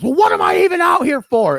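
high, loud mock outburst; imitated yell. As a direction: yell it like a baffled manager. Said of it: "you can hear him yelling".

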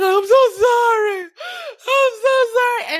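high, slow, loud pleading cry; pleading apology. Retold or imitated. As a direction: beg her forgiveness, voice rising high. Begging grandma in a dream.

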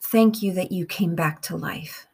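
slow, measured meditation with long pauses; guided prayer. Real, not acted. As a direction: pray phrase by phrase, unhurried, leaving room to repeat.

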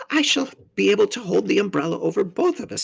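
high-pitched faun character voice; obliging faun. A narrator's reading. Faun sharing his umbrella with the girl, high and obliging.

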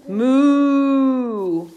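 long drawn-out moo; cow moo. Acted. Animal sound in story.

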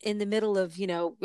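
usual speaking voice

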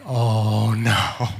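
low, hushed-down "oh no" of dread; ominous dread. Retold or imitated. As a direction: let the dread sink in, deep and understated.

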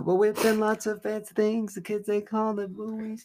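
high, loud mock rap voice; high rapper parody. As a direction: ham up a rapper's boast.

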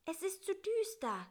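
small, high and plaintive; a little fly grumbling high up. Acted. Bissi to the spider at night, high and plaintive.

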